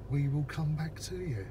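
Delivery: ordinary delivery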